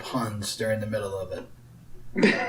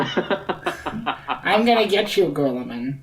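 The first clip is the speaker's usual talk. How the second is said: slow nasal nerd impression; nasally nerd voice; 4 dB quieter, much slower than usual. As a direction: whine it like the Revenge of the Nerds nerd. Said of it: "his voice like that one dude".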